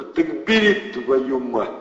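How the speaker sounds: high, loud gangster urging; pushy thug. A narrator's reading. Thug pushes Andrey to buy, loud and brash. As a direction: shove the deal on him.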